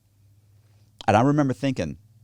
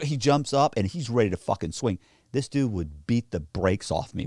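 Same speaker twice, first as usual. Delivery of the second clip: ordinary delivery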